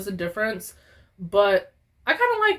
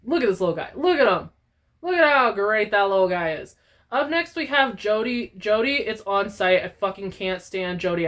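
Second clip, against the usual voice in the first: normal talking voice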